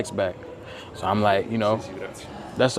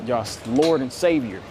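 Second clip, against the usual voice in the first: usual speaking voice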